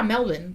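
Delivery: Melbourne said as MEL-bun, no r; Australian-style pronunciation. Retold or imitated. Quoting the driver confirming it, Australian-style pronunciation. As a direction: end Melbourne on a short "bun". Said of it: "That's how it's pronounced".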